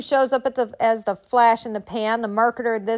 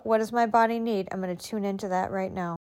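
ordinary talk, nothing marked

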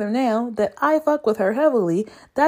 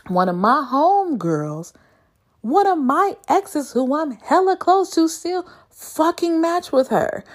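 ordinary talk, nothing marked

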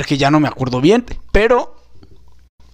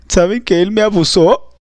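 ordinary talk

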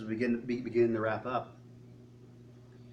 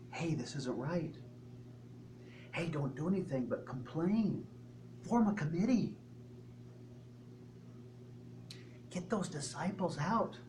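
hushed, mildly conspiratorial mock whispering; sly little whisperings; 2 semitones higher, 8 dB quieter than usual. Satan slipping complaints into believers' ears, hushed mock whispering. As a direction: softly plant the idea, stopping short of a true whisper. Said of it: "Just little whisperings in the ears".

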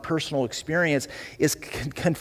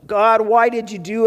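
loud, higher cry of a panicking captain; captain in the storm, urgent and loud; captain's panicked outcry; 7 semitones higher, 5 dB louder than usual. Retold or imitated. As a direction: shout over the storm in alarm. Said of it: "this captain's saying".

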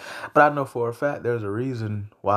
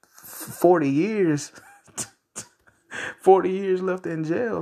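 laughing disbelief; 8 semitones higher, slower than usual. Real, not acted.

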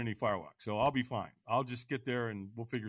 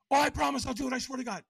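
high, over-earnest retold oath; eager promise; 12 semitones higher, faster than usual. Buddy begs to borrow the program, eagerly promising. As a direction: imitate a buddy swearing, pitched way up.

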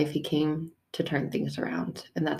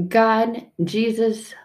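exasperated plea; 5 semitones higher, 5 dB louder than usual. Acted.

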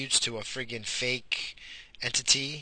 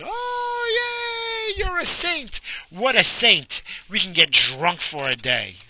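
much higher, louder fake hooray; sarcastic cheer; 11 semitones higher, 5 dB louder, slower than usual. Mocking Saint Patrick for allowing one drinking day, fake cheer.

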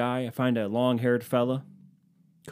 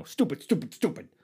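higher, repeated self-reproach; mock self-scolding; 7 semitones higher than usual. Man berating himself over the cart request, mock self-scolding. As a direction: beat yourself up in a mock voice.

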